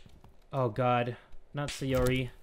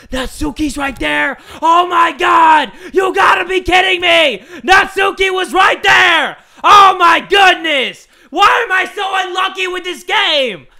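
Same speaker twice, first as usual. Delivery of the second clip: loud, higher yelling in disbelief; frustrated shout; 9 semitones higher, 11 dB louder than usual. Real, not acted. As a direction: scream at the game. Caught by Natsuki, yelling.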